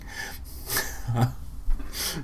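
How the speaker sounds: airy breathless laugh; breathy laugh. Real, not acted. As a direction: laugh helplessly under the breath. Cracks up at the passage.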